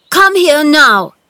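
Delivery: high, loud, drawn-out order; insistent slow yell; shouted command. A narrator's reading. Boy demanding Dickon's help at once, shouting. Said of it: "he shouted to Dickon".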